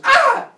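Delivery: high, very loud cry of alarm; hammed-up falling yell; mock scream. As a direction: scream as you go over the edge. Uranus falls off the edge.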